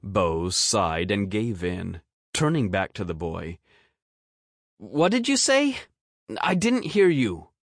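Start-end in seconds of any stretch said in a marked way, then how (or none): 4.8-7.5 put-on high kid voice, a bit impatient; young boy voice. A narrator's reading.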